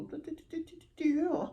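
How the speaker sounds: high-pitched sing-song da-da babble; mock fancy chatter. Mimicking wedding guests' polite talk, high mocking babble. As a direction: trill nonsense like fussy polite guests.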